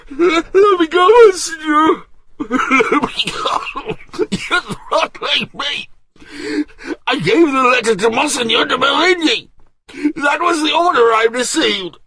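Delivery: very high, loud choking panic; terrified. Acted. Bersamet being throttled by Artois, terrified. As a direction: beg to be let go in terror. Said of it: "in a terrified voice".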